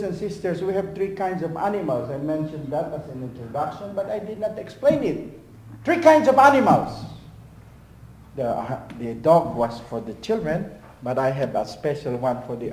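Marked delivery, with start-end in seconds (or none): 5.8-7.0 loud, high preacher's emphasis; loud emphatic declaration. Acted. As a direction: proclaim it to the hall. Preacher declaims point loudly.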